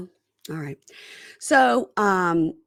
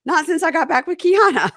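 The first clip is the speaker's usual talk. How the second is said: loud, higher mock denial; imitating a cast member brushing it off; quoted denial; 4 semitones higher, 6 dB louder than usual. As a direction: rattle off the nahs and rise. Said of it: "and he goes".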